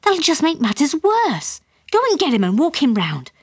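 high, agitated instruction; worried mother taking charge; voice up a notch. A narrator's reading. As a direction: push the pitch up and fret. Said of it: "voice going up a notch".

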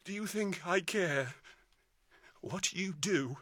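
a defeated husband fading into despair. Acted. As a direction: let the voice drain out to nothing. Despairing retort to his wife.

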